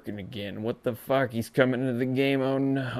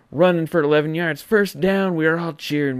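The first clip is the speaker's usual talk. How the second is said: higher, louder and fast, caught up in the play; excited recap; 6 semitones higher, 8 dB louder than usual. Acted. Host to fans, reliving the run excitedly. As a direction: race through it like the run is happening.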